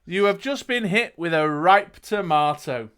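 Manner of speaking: dramatic storyteller, key words punched; game master narrating the action; gamebook narration. A narrator's reading. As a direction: read it out like an adventure tale. Reads gamebook aloud to player.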